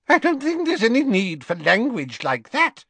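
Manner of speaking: a fluttery shopkeeper tutting; fussy Madame Malkin. Acted. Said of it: "said Madame Malkin".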